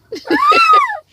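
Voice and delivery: a high, loud burst of laughter; real laugh. Real, not acted. Laughs after yelling.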